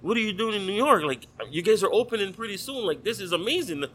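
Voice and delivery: mock awestruck fan voice; excited fan. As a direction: play the amazed chef who can't believe it. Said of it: "the guy was like".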